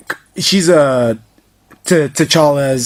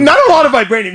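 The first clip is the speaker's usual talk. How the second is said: high laughing protest; 10 semitones higher, 3 dB louder, faster than usual. Real, not acted. Mock protest while laughing.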